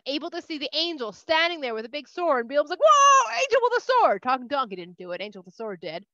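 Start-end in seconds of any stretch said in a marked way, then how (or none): 2.8-4.2 loud, high startled gasp of a line. Acted. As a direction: jolt back at the sight of the angel. Bilam suddenly sees angel.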